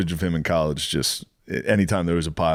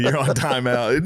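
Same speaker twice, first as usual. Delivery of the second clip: ordinary delivery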